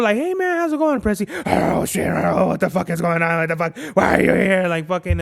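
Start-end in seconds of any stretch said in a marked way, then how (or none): 0.2-1.3 much higher, easygoing dog voice; friendly dog. Acted. Strange dog running over to Presley, high friendly greeting. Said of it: "the dog is, like, running over". 1.5-4.7 rasping, voiceless mock-panicked dog; dog flipping out. Presley the dog reacting to a big dog, raspy mock freakout. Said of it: "he flips the fuck out".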